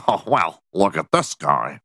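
sneering mock nerd, lightly voiced; lightly voiced smug nerd mocking a costume; snide nerd. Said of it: "some nerd being like".

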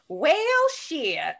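deadpan Southern sigh of defeat; Southern drawl quote. Retold or imitated. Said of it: "I just walked in and went".

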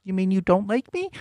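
high-pitched mock-hurt question. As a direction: ask it high, feigning hurt. Playful fake-hurt line in game.

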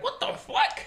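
high breathy shocked cry; mock panic; startled. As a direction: caught off guard, squeaky. Brother notices dad gone.

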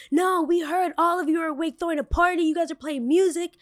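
high, whiny accusing voice; mock the neighbor's complaint up high. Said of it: "neighbor's reply retold".

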